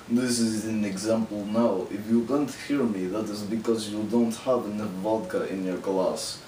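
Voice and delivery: put-on Russian accent. Shown as a demonstration. Vlogger previewing a voice for other videos, Russian accent. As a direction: do a Russian character voice for the camera. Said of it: "I'm going to be doing my Russian voice".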